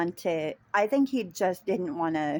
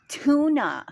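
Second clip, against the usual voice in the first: higher, louder, one-word bark; loud curt customer; 8 semitones higher, 7 dB louder than usual. Retold or imitated. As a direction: snap the single word back like an impatient customer. Rude customer repeats his order.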